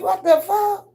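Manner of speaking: very high "what the fuck"; shocked outburst. Retold or imitated. Leaving the chat in shock, very high. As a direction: blurt it in disbelief. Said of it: "I'm like".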